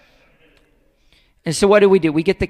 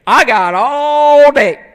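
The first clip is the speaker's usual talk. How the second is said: loud, higher, long drawn-out taunt; stubborn parent; 5 semitones higher, 9 dB louder, much slower than usual. Acted. Parent waiting out a child's tantrum, loud drawn-out defiance. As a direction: stretch it out loud, refusing to budge.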